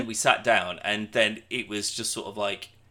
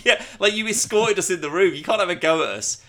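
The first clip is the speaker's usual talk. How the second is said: indignant protest; 9 semitones higher, 8 dB louder than usual. Retold or imitated. Indignant retort to the old men.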